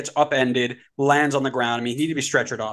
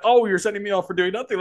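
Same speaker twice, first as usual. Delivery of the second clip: higher-pitched imitation of grievance; mock complaint; 6 semitones higher than usual. Coach protesting his red card to the referee, mock aggrieved. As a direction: play the wronged coach.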